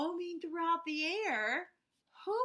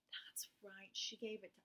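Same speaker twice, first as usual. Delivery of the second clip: whispered; 6 semitones lower, 9 dB quieter than usual. Real, not acted. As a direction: breathe it like a shared secret. Teacher whispers answer to kids.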